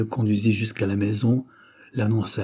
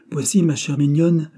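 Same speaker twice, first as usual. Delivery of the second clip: lightly voiced guardian, higher and louder; doctor to his ward; 6 semitones higher, 6 dB louder than usual. A narrator's reading. Doctor presenting a young man to his ward, lightly acted. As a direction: address a dear ward, pitch lifted.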